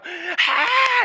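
high-pitched mock crowd cheer; high crowd "aah"; imitated crowd yell. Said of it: "people would go".

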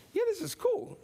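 high-pitched mock giddiness; playful, very high excitement; giddy delight. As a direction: squeal it like you're thrilled. Imagines himself walking on water.

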